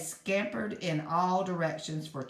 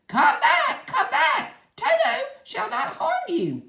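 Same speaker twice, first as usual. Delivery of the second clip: much higher, louder laughing call; 8 dB louder than usual. A narrator's reading. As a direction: shout after the fleeing mice. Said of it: "while he called to the mice".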